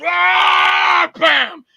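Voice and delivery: loud, high drawn-out roar then bam; attack roar. Acted. Bald monster guy attacks him, roaring yell. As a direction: roar and strike with a shouted bam.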